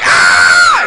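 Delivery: loud low startled scream; scared scream. Retold or imitated. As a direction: scream as the girl runs. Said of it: "She's like".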